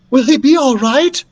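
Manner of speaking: high, loud worried demand. Acted. Beatrice demands news of Daniel from Doctor Drake, loud and worried. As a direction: press hard for an answer, worried. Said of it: "demanded Beatrice".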